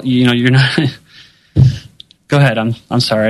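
ordinary talk

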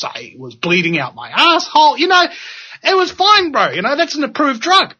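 high, cheerful voice listing horrors; breezily high, like it's all fine; a mock pharmaceutical patient brushing off side effects, high and cheerful.

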